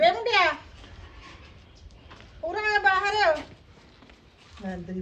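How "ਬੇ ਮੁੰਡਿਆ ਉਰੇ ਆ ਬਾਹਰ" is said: loud, high call across the yard. Acted. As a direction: summon the boy with a long shout. Mother calls son outside.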